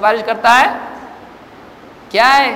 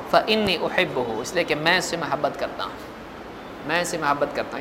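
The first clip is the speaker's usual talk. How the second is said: much softer and lower, full of love; tender and soft; 6 semitones lower, 10 dB quieter than usual. Retold or imitated. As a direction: say it gently like a loving father. Prophet's tender words of love. (نرم، دھیمی اور محبت بھری آواز)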